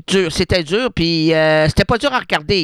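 usual speaking voice

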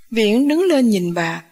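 usual speaking voice

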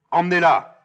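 loud, high command; shouted order. Acted. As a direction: bellow an order to the guards. Créon ordonne aux gardes. (ordre hurlé)

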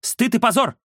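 high-pitched scornful exclamation; indignant snort. A narrator's reading. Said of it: "фыркнула журналистка". (презрительное фырканье высоким голосом)

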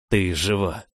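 relieved exhale of words; breathed out. A narrator's reading. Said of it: "выдохнул".

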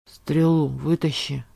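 low, slow gurgling voice, straining in pain; strange gurgling voice. Acted. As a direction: gurgle the words out through blood, slowly. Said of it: "странным булькающим голосом".

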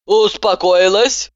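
slow, stern ask; stern question. A narrator's reading. Doctor asks her patient if she's calmed down. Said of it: "строго спросила она".

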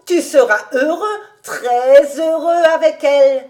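wide-eyed, happy enthusiasm; delighted admiration. A narrator's reading. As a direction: marvel loudly and approvingly. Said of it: "les yeux écarquillés d'admiration".

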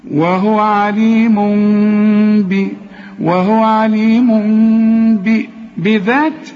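slow chanted عليم, long half-m hum before "bi"; hidden m. Shown as a demonstration. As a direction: hum the end of عليم with lips barely parted. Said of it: "اخفي الميم". (إخفاء الميم بغنة في عليم)

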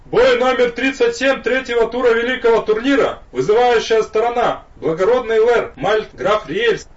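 high, loud nasal herald announcement; town crier. A narrator's reading. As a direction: proclaim like a nasal tournament herald. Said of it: "гнусавый голос, объявивший".

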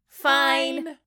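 loud, high cheer of Fine. Acted. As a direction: shout it out gleefully. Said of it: "the twins cheer".

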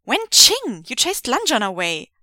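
loud, high snap; snapping. Acted. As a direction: snap it at her. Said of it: "Wei Ying snaps".

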